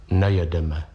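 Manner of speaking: low, hollow reply from a sad gentleman. A narrator's reading. Sad gentleman curtly corrects the postman, hollow voice. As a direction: answer low and hollow, in sorrow. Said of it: "řekl dutým hlasem smutný pán".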